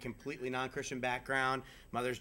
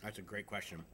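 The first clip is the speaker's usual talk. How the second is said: talking around ice; words through a mouthful of ice; 3 semitones lower, 7 dB quieter than usual. Real, not acted. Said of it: "And I have ice in my mouth".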